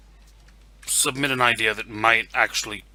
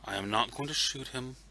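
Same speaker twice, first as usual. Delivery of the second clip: very soft muttered self-restraint; hushed mantra, talking yourself down; under the breath; 12 dB quieter than usual. Acted. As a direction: repeat it to yourself, barely audible. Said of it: "I am repeating under my breath".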